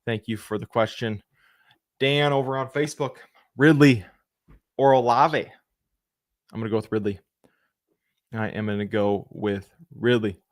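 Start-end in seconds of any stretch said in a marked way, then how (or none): none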